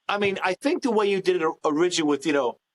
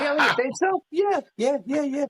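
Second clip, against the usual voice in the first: high mock voice; 7 semitones higher than usual. Wife then husband voiced.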